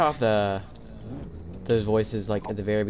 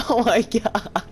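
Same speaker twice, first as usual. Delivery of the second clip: high, drawn-out laughing wail; laughing exasperation; 12 semitones higher, 4 dB louder, slower than usual. Real, not acted. Player whose careful parking is spoiled, laughing exasperation. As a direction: draw it out high through laughter.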